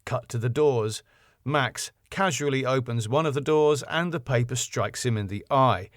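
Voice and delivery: normal talking voice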